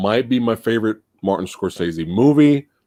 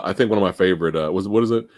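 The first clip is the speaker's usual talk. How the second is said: ordinary delivery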